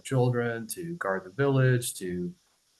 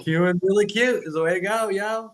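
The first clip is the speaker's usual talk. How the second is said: coaxing adult; 9 semitones higher than usual. Retold or imitated. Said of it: "You go".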